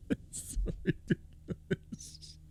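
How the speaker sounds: soft, slow words breathed out through laughter; real helpless laughter; laughing. Real, not acted. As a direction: wheeze it out mid-laugh. Laughing at the clip.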